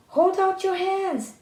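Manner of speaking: shouting. A narrator's reading. Tom shouting to the thieves to raise the alarm. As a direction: yell it to wake the maid. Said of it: "he shouted".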